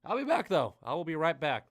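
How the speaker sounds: loud, upbeat farewell; excited sign-off. Real, not acted. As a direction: hype them up before break. Sign-off to viewers.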